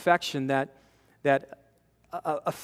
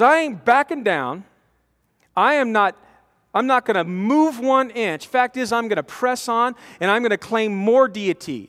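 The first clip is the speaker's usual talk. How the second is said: higher, louder, firm refusal to back down; bold defiance; 4 semitones higher, 4 dB louder than usual. Retold or imitated. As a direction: stand your ground boldly, voice raised. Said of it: "He becomes more bold".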